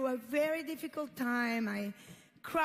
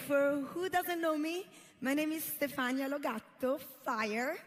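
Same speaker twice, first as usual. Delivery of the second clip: ordinary talk, nothing marked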